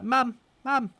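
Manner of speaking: put-on high child voice calling mother; small boy piping up for his mum; Carp, young boy. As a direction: call for mum as a little lad. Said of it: "he runs up and he's like".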